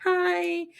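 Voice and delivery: high, sing-song mock greeting; creepy cheery Tara. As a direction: pop up with eerie glee. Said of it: "Tara pops up and is like".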